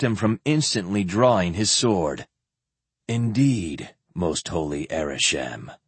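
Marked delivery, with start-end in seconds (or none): 3.1-4.0 lower, calm warrior voice; Indeed said low and resonant; deep resonant voice. Acted. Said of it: "in his deep, resonant voice". 4.1-5.8 lower, softer warrior voice; deep resonant voice. Acted. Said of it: "in his deep, resonant voice".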